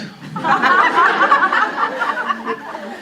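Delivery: a high, bright burst of laughter; hearty laugh. Real, not acted. Teacher laughing at a child's answer, high laugh.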